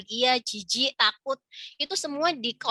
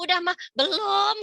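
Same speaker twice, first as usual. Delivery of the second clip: imitated call-and-answer; 7 semitones higher than usual. Mother answering child from the bathroom, imitated calling. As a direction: act out an impatient child and a mother answering back. Repeated exchange through bathroom door.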